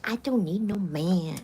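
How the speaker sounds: slow, mildly mocking sassy woman. Mocking women who say they need no man, slow and sassy. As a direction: mimic a woman who sounds stupid saying it. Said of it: "Anybody who talks like that".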